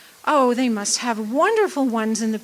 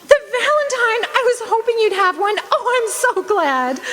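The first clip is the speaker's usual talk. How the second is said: excited joy; 9 semitones higher than usual. Acted. Annie overjoyed at the valentine.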